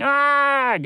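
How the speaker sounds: high loud panicked yell. A narrator's reading. Toucan yelling as he expects fire, loud. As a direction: brace for impact and yell.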